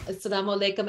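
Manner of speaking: normal talking voice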